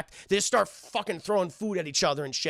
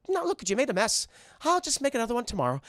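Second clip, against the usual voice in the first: higher, fussing cartoon voice; chiding Muriel impression; 5 semitones higher, faster than usual. Muriel to dog about the mess, chiding. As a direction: fuss lightly over a mess.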